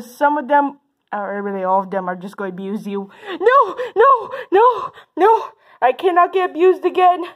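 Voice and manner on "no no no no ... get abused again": high, pleading wail; pretend panic. Bullies hearing parents are angry, panicked pleading. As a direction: play a kid begging in panic.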